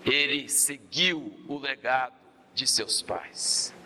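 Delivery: low, slow and weighty; solemn preaching. Acted. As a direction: lay it down slowly like a grave truth. (pregação solene, grave e lenta)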